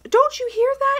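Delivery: high, loud frightened question; terrified child. Retold or imitated. Son with hands over his ears, high and frightened. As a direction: cry it out like a scared boy.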